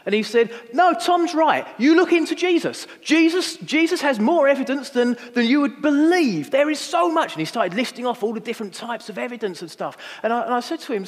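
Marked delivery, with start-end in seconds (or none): 0.7-7.3 big, loud, high-pitched declaration; brazen, emphatic voice carrying across a bar; Chad. Retold or imitated. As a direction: boom it out boldly to back a friend up. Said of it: "imagine the big Canadian voice".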